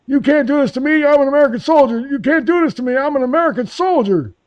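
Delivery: mock wail in a high, strained voice; very high mocking outcry; high-pitched protest. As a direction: imitate an arrested soldier protesting, way up high. Drunken arrested soldier protesting.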